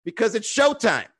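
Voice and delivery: high, loud mock outrage; demanding heckler. Audience member orders a down comedian to be funny, demanding. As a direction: heckle the comic indignantly, pitched well up. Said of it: "people like Immacula show up and be like".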